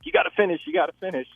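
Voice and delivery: imitating young daughter. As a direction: mimic a kid's countdown urging in a high voice. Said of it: "I heard my daughter doing a countdown".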